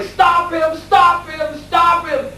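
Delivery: very high, repeated cries for help; sustained high mock shout to passers-by; imitated shouting. Father alerting shoppers to his escaping son, imitated shouting. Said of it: "his father was shouting".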